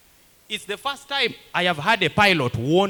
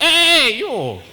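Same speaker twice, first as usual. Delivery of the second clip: soldier calling out; 7 semitones higher, 4 dB louder than usual. Retold or imitated. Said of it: "looked at me and said".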